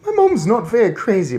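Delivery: put-on loud, high boy's voice; a boy stating it plainly. Bruno warning grandmother, boy voice. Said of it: "Bruno said".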